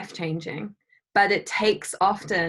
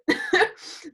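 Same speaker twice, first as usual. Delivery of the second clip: high burst of laughter; 11 semitones higher than usual. Real, not acted. Laughing at own example.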